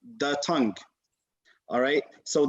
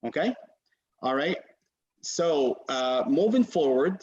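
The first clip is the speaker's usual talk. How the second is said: ordinary talk, nothing marked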